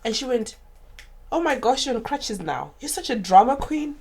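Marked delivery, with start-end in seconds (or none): 1.3-4.0 higher, louder imitation of a scornful relative; exaggerated teasing outburst, retold; mock exclaiming. Sister-in-law dismissing her crutches on a video call, mocking.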